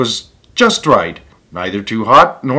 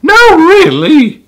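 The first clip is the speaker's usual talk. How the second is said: much higher, louder, slow exasperated voice; Mama Bear scolding; 8 dB louder, slower than usual. Acted. Mama Bear to Baby Bear, a high exasperated scold. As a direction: chide the little one like a fed-up mother. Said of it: "said Mama Bear".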